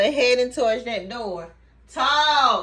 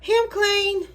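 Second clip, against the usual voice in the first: pet talk; 10 semitones higher, 3 dB louder than usual. Real, not acted. Owner blows on the clean fur, high cooing. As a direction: coo it high and delighted.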